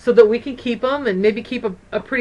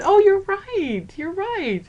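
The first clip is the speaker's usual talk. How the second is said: delighted realization; 9 semitones higher, slower than usual. Retold or imitated. Grandma agrees with grandson.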